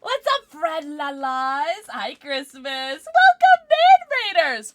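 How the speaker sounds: high, slow drawn-out greeting; sing-song greeting. Real, not acted. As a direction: stretch the welcome out.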